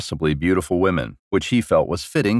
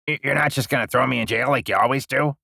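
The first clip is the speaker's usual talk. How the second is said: fast, higher-pitched nervous stammer; anxious; 4 semitones higher, faster than usual. Acted. Prisoner fearing more than jail, anxious. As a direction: stumble into a worried, hurried question. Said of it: "Kerr said anxiously".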